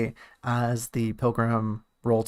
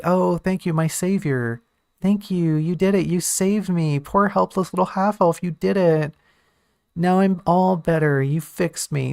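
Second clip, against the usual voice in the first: sarcastic, higher, sing-song thanks; mock gratitude; 5 semitones higher, 3 dB louder than usual. Teal mock-thanking the pilgrim for saving her, sarcastic. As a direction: overdo the grateful rescued damsel to mock it. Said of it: "Teal's first reaction isn't gonna be".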